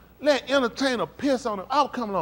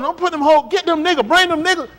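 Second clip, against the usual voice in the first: louder and higher command to the stage crew; retold loud order; 9 semitones higher, 9 dB louder than usual. A rapper on stage orders crew who to bring up, loud. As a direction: bark the orders over the crowd. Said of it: "He told them".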